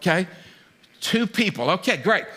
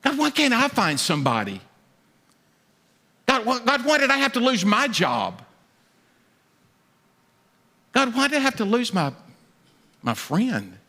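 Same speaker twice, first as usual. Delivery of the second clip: pleading God why; 5 semitones higher than usual. Retold or imitated. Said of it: "you just said".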